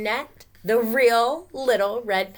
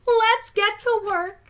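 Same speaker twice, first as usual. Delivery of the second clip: high eager shout; rally everyone; excited cheer; 5 dB louder than usual. Acted. The supplies cheering to start work.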